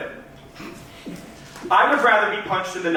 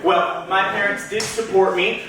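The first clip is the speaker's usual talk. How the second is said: ordinary delivery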